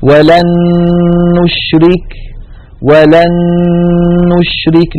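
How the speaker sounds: ولن نشرك recited with the two nuns merged into one doubled, nasal نّ; slow Quranic recitation, ولن نشرك run together as "walan-nushrik"; ولن نشرك chanted with a held, nasal merged nun; tajweed idgham demo. Shown as a demonstration. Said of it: "النون الأولى قد صارت مع النون الثانية نونا واحدة مشددة".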